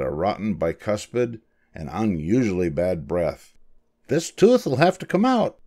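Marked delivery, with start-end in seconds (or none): 4.1-5.6 high-pitched little mouse dentist; small doctor making a pronouncement; announcing. A narrator's reading. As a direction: declare it in a high character voice. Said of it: "Doctor DeSoto announced".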